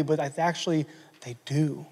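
soft whisper; whispered admission. Acted. Believer admitting sin to God in a whisper. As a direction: let the confession slip out in a whisper. Confessing in prayer, hushed.

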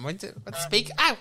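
usual speaking voice

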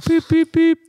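high, loud beeping; imitated phone beeps. Comic tells friends how they hung up, high mimicked beeps. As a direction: beep like a dead phone line.

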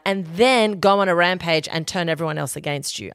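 ordinary talk, nothing marked

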